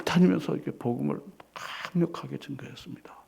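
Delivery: soft breathy whisper. Real, not acted. Pastor telling of Peter preaching, whispered. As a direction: sink into a whisper.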